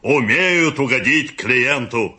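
loud, drawn-out approval; hammed-up praise from a customer; emphatic agreement. A narrator's reading. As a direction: agree heartily, stretching it out. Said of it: "согласился четвёртый".

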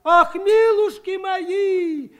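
high, slow, loud gasp of shock; gasping woman. A narrator's reading. A village woman gasps at the bone, high and shocked. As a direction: cry out in horror. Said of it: "ахнула одна из баб".

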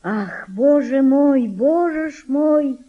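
slow, sighing mutter; sighing lament. Acted. Tired mother sighing to herself, slow weary lament. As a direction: sigh out the words wearily. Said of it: "пробормотала она с тихим вздохом".

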